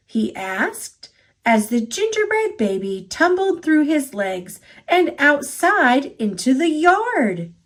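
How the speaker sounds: plain reading voice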